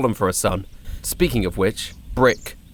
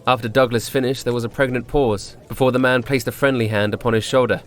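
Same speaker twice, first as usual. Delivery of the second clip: plain reading voice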